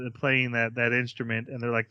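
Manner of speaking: ordinary talk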